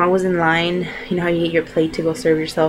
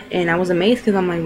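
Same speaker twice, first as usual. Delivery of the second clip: ordinary talk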